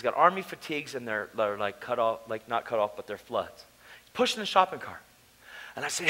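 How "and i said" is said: normal talking voice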